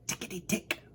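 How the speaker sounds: soft little tickety voice of small clocks; tiny ticking voice. Acted. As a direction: tick it out lightly like a tiny clock. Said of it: "said the little ones".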